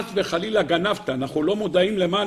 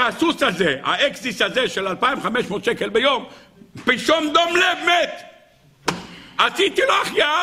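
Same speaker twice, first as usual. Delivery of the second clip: frantic high-pitched report; agitated servant; 6 semitones higher than usual. Acted. Servant telling his master about the horse, agitated. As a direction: pitch climbing into a shouted bad-news report.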